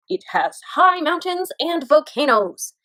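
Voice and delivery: ordinary talk, nothing marked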